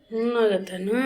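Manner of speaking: soft whimpering sob. Real, not acted. Sick child whimpering.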